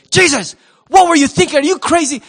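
high, loud exasperated scold; scolding parent. Retold or imitated. Mary and Joseph scolding twelve-year-old Jesus, loud and high. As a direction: let the parental relief boil over into scolding.

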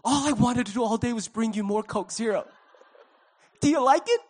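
high, gushing mock enthusiasm; eager server. Server thrilled to bring a refill, hammed-up eagerness. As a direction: gush like an over-keen server.

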